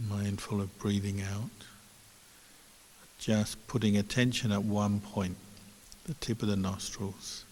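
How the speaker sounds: soft, unhurried meditation guidance with long pauses; guided meditation. Real, not acted. Teacher guiding online listeners to watch the breath, quiet meditation voice. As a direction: lead them gently into stillness, leaving space between phrases.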